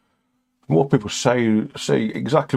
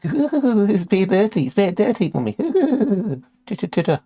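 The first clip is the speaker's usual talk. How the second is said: put-on much higher, giggly little-kid voice; mock giggling child; 11 semitones higher than usual. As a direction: tattle to mummy like a naughty child.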